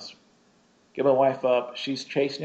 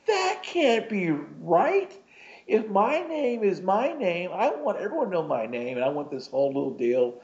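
indignant protest; 7 semitones higher than usual. Retold or imitated. Arguing with his invisible horse over its name, indignant. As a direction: refuse to accept it, pitched well up. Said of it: "argument with my invisible horse".

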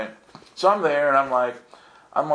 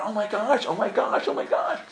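high, overwhelmed repeated gasps; freaking out; 8 semitones higher than usual. Retold or imitated. Pilgrim with hands in the dirt, overwhelmed high muttering. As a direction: repeat it as if overcome with awe. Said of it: "I'm freaking out".

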